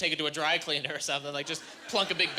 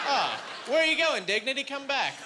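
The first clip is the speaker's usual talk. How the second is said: mock pleading call; 6 semitones higher than usual. Calling after his departing dignity.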